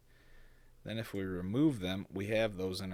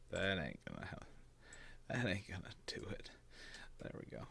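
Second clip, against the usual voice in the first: whispered; 7 dB quieter than usual. Real, not acted. Muttering to himself at screen.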